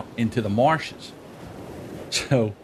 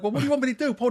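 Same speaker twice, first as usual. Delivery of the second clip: high-pitched mock exasperation; exasperated retort; 13 semitones higher, much faster than usual. Son answering his hurting father, high exasperated protest. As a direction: protest helplessly in a raised voice.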